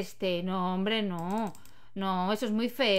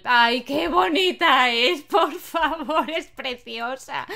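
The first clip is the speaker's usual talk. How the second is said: gushing; 3 semitones higher, 7 dB louder, much slower than usual. Real, not acted. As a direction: melt over how cute she is. Gushing over a baby Sim.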